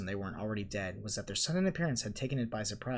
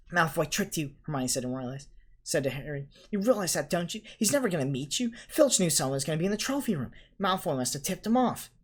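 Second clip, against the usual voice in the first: lightly voiced know-it-all girl; a hint of a lecturing schoolgirl; bossy girl voice; 5 semitones higher, 4 dB louder than usual. A narrator's reading. Hermione's lecturing voice.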